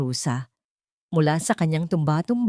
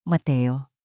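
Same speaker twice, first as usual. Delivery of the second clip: calm but weighty; 3 semitones lower than usual. A narrator's reading. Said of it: "mahinahon ngunit may bigat".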